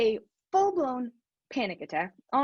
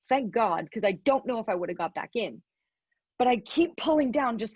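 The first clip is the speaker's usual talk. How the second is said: normal talking voice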